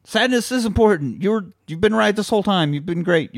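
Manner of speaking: high-pitched earnest admission; imitating an eager apology. Acting out apology to Sadness.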